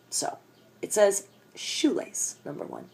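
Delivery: ordinary talk, nothing marked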